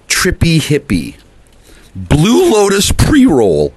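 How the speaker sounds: slow, drawn-out ad-style pitch; mock product pitch. Selling his joint in a slow mock ad voice. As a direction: savor each word like a commercial.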